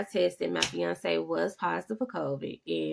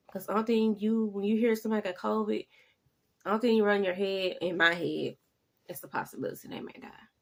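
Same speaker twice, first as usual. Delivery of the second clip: ordinary talk, nothing marked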